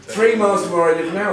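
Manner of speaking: real laughter breaking into the words; laughing. Real, not acted. Laughing at own jockey photo.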